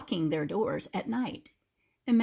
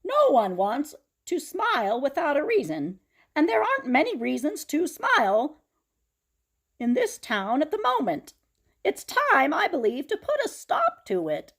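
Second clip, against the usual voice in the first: high, loud pulpit voice with a laugh; rally the town from the pulpit; preaching pastor; 9 semitones higher, 6 dB louder than usual. Acted. Pastor addresses town meeting.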